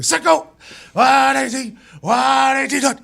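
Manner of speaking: high-pitched quarterback call; regular snap cadence. Retold or imitated. Quarterback calling for a practice snap, high chanted cadence. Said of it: "I do it regular".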